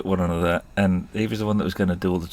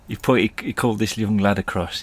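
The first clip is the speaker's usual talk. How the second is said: usual speaking voice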